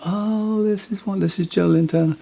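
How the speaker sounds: high, breathless fangirl swoon, hammed up; swooning girls. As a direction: imitate starstruck girls cooing over a rock star. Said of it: "and all the girls going".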